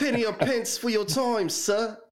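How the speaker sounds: high mock British accent, with a laugh in it; hammed-up British beggar. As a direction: play a Victorian beggar asking for coins, British accent. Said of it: "what's your best British accent".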